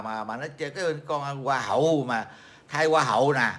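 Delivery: ordinary talk, nothing marked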